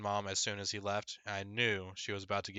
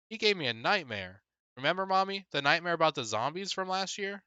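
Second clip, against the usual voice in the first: put-on high, louder little boy; little kid voice; 9 semitones higher, 4 dB louder than usual. Little brother telling mom his nightmare, high kid voice. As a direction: sound like a small excited kid.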